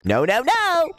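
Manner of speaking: squeaky high cartoon voice shouting; shrill kart-racer warning, very high; game announcer voice. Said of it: "it's all like".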